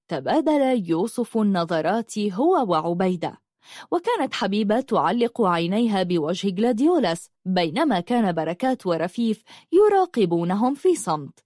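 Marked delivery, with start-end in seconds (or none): none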